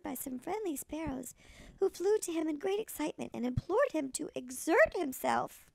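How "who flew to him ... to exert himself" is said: usual speaking voice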